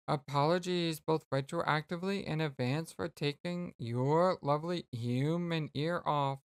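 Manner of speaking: ordinary talk, nothing marked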